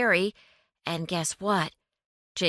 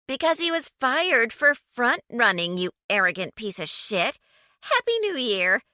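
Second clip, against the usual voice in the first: higher, louder sneering retort; tipsy contemptuous woman; 6 semitones higher, 4 dB louder than usual. A narrator's reading. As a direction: toss the insult off merrily. Drunk woman insults caller.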